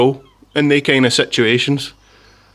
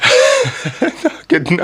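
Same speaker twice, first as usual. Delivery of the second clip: high-pitched real laughter into words; laughing; 12 semitones higher than usual. Real, not acted. Rider recalling being watched by policewoman, really laughing. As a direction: burst out laughing as you start. Laughing at his own story.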